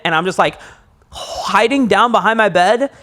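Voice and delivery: ordinary delivery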